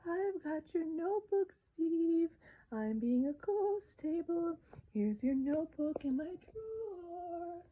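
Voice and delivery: high, slow ghost voice; ghostly voice. A narrator's reading. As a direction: float it out like a friendly ghost. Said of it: "spoke in a ghostly voice and said".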